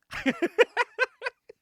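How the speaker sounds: a loud, high burst of laughter. Real, not acted. Telling the plot twist to a friend, loud laughter. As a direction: crack up at the twist.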